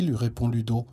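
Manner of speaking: usual speaking voice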